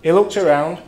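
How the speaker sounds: ordinary talk, nothing marked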